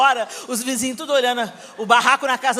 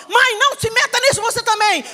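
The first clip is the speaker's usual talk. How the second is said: higher, louder defiant shout; gritando com a mãe; 9 semitones higher, 4 dB louder than usual. Retold or imitated. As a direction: snap back at mom. (filha desafiando a mãe aos gritos)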